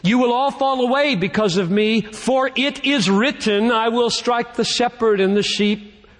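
high-pitched, declamatory Bible reading; Scripture proclamation. Acted. Preacher reads prophecy to the congregation, proclaiming in a high pitch.

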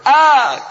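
loud high wail; mock child's wail. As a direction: imitate a spanked child's cry. Acting a spanked child crying.

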